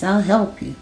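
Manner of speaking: ordinary delivery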